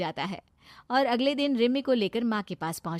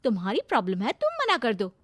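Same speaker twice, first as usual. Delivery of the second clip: lightly voiced, higher, insistent girl; bossy young woman character; 5 semitones higher, 7 dB louder than usual. Girl tells her suitor to refuse the match himself, bossy. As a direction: play her a touch higher and pushy.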